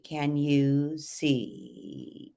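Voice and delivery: slow creaky fry on the line; a slight creak in the voice; subtle vocal fry. Shown as a demonstration. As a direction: let the end creak lazily. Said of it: "If you were doing this with fry".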